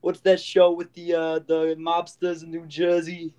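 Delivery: hammed-up New Jersey talk; New Jersey accent. Host chatting about mobster shows on request, New Jersey accent. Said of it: "That was my New Jersey accent".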